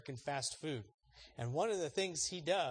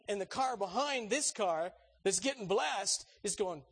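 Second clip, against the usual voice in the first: ordinary delivery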